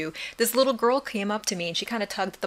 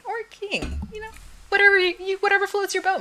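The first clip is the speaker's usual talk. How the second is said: playful high exclaim; 12 semitones higher than usual. Acted.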